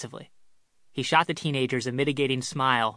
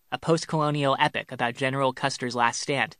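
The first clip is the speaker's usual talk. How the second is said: ordinary delivery, nothing marked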